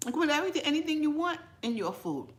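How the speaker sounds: ordinary talk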